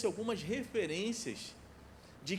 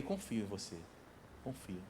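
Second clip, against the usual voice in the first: much lower and softer, quiet trust; tender reassurance; 12 semitones lower, 12 dB quieter than usual. Retold or imitated. Father answering son's question, soft tender reassurance. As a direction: reassure him gently, almost murmured.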